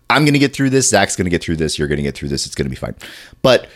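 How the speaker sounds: ordinary delivery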